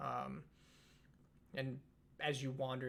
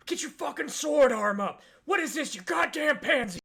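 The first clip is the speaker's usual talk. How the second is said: high, loud drill-sergeant yell; angry instructor shouting abuse; 12 semitones higher, 12 dB louder than usual. Acted. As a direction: berate a struggling trainee at full voice. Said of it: "he's yelling at you".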